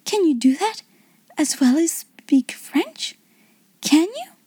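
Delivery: high-pitched, incredulous questioning; astonished child, voice lifting high; gasped amazement. A narrator's reading. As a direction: ask in breathless wonder, rising at the end. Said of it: "she gasped".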